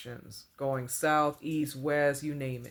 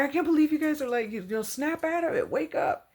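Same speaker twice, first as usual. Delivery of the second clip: much higher, fast, worked-up disbelief; riled up; 13 semitones higher, 3 dB louder than usual. Retold or imitated. To her stunned friends at the park, a much higher riled-up outburst. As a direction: shake them out of their daze. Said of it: "you know, riled up".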